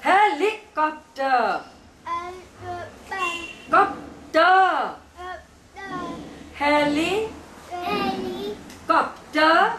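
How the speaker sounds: "helicopter" drawn out in separate beats; syllable by syllable. Shown as a demonstration. Teacher has child repeat along, syllable by syllable. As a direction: break "Heli" and "Copter" into slow, clear syllables. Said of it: "say these things with me".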